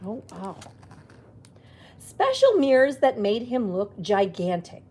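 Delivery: plain reading voice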